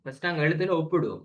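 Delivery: ordinary delivery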